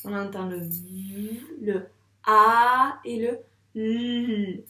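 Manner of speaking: v, a, l étirés très lentement, le a et le l plus forts; égrener chaque son; sounds drawn out. Shown as a demonstration. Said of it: "dis-moi les trois sons". (sons v a l articulés un à un, lentement)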